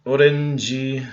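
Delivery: "Orenji" said slowly, syllable by syllable; Konglish "Orenji" said slow; slow Korean loanword. Shown as a demonstration. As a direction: spell out "Orenji" carefully. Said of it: "orange juice is orange juice".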